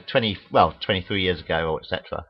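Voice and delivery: ordinary delivery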